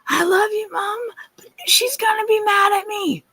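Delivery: put-on high, loud crying scream of a scared child. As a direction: sob it out like a frightened little girl. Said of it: "she started screaming".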